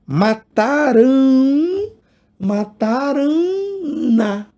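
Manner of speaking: "mataram" drawn out slowly, then "na" added; slow demo. Shown as a demonstration. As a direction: spell out "mataram na" syllable by syllable. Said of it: "É só pra manter o ão nasal".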